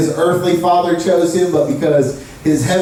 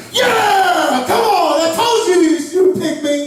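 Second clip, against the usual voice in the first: put-on high-pitched, indignant kid; excited outburst, pitched high; worked-up protest; 9 semitones higher than usual. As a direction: protest at being passed over. Acts kid protesting the pick.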